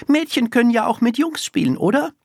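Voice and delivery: put-on high, quick child's voice; arguing little girl. A narrator's reading. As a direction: rattle off the argument as a small girl. Little girl argues back.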